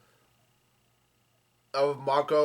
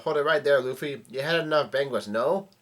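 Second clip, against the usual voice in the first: ordinary delivery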